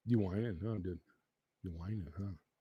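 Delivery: low, very soft questions; hushed, low teasing of a pet; soft pet talk. Real, not acted. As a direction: drop low and quiet to ask the dog. Murmuring to his whining dog.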